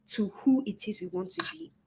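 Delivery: whispered sincere ending; hushed heartfelt close; soft whisper. Real, not acted. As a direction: drop to a whisper on the last words. Sincere close of her point.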